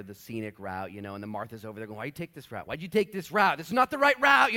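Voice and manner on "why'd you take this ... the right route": building to a high, loud complaint; irritated Martha. Retold or imitated. Martha in the passenger seat griping at Mary. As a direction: nag harder each time.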